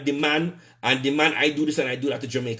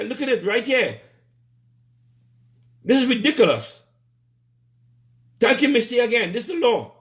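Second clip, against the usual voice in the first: angry rant; 6 semitones higher than usual. Real, not acted. Ranting to viewers about ministers defying the law, angry and raised. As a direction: fume at the law being ignored. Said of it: "I'm just angry".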